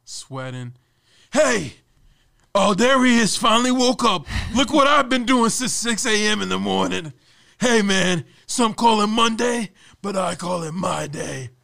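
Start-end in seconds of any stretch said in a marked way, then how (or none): 1.3-11.5 loud, high, breathless bragging; loud pumped-up boss. Retold or imitated. Boss calling while running on the treadmill, loud bragging. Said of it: "that's what my boss is like".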